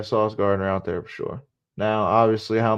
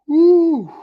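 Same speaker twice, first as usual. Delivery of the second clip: sudden high cheer; high woo; 10 dB louder than usual. Real, not acted. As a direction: let out a loud woo. Lone cheer.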